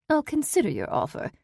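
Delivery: the aunt coolly keeps her options open; cool reply. A narrator's reading. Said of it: "Ruth replied coolly".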